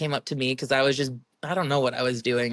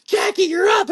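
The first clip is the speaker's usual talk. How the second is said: calling out; 6 dB louder than usual. Retold or imitated. Official calling her up.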